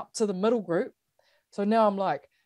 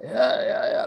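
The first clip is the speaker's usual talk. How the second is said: puffed, airy yeahs; imitating breathlessness; 4 semitones higher, slower than usual. Runner answering coach mid-plod, mock out of breath. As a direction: pant the answer as if winded. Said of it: "can't breathe, can't really talk".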